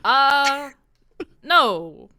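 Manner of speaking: lightly voiced, baffled refusal; confused halfling. Halfling refusing to be eaten by a stranger, confused. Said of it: "I'm just a little confused".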